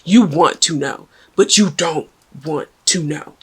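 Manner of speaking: normal talking voice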